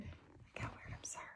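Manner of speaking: whispered embarrassed aside; whispering. Real, not acted. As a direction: breathe it out, embarrassed.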